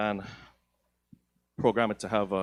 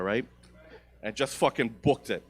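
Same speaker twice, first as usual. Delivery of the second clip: normal talking voice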